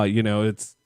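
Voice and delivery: usual speaking voice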